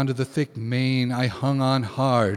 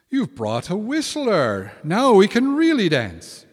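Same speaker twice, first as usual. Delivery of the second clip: excited fairies; 8 semitones higher, 6 dB louder than usual. Acted. Fairies cheer the whistler.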